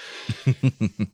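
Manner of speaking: embarrassed nervous laughter. Real, not acted. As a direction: laugh awkwardly. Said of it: "nervous laugh".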